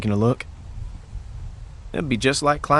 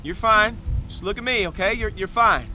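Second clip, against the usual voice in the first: calm, steadying reassurance; reassuring; 8 semitones higher than usual. Acted. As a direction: talk her down gently. Steadying a slipping climber.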